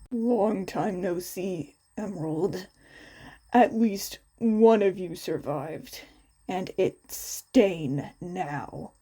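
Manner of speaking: low, menacing villain voice; Stain's voice. A narrator's reading. Stain greeting Emerald after years, low and menacing.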